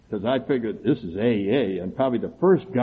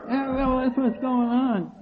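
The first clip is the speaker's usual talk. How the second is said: higher, slow indignant answer; indignant retort; 12 semitones higher, slower than usual. Retold or imitated. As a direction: stretch it out, stung. Retold retort to chairman.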